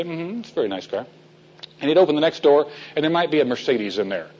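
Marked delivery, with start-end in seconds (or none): 0.0-1.1 unimpressed murmur. Retold or imitated. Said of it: "And Ian would say".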